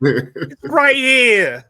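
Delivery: put-on slow, stretched-out loud Brooklyn accent. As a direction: drag it out slowly in a Brooklyn accent. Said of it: "You mean my, my Brooklyn accent?".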